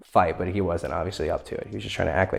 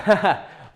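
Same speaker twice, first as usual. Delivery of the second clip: short laugh; 11 semitones higher, 5 dB louder than usual. Real, not acted. Laugh after a confession.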